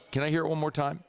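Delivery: ordinary talk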